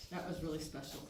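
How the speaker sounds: ordinary delivery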